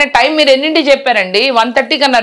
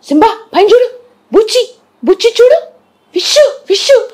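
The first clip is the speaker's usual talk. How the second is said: calling the cat; 11 semitones higher than usual. Real, not acted. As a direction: coax the cat to look up in a much higher voice. Calling her cat to look up. (పిల్లిని పిలుస్తూ ఎత్తైన ముద్దు గొంతు)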